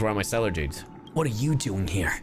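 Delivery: ordinary delivery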